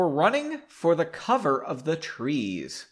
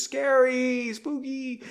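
high, airy mock spooky voice; 9 semitones higher, slower than usual. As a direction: play at being a ghost.

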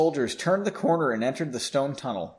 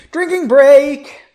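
loud, high, drawn-out call; announcing a break; 11 semitones higher, 12 dB louder, slower than usual. Real, not acted. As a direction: sing out the announcement.

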